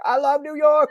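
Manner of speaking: high, loud mock joy; exuberant movie shout. Mister Deeds jumping trash cans, imitated joyful shout. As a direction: holler it with hammed-up elation.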